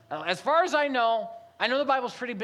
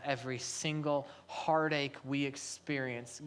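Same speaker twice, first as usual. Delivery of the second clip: ordinary talk, nothing marked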